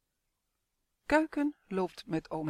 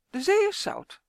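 grandma hen; 11 semitones higher, 5 dB louder than usual. A narrator's reading. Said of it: "zegt oma Kip".

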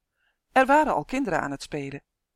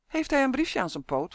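higher, hopeful question about the duck; eager question; 7 semitones higher than usual. Acted. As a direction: lift the whole question up with eager hope. Vroeg Justin.

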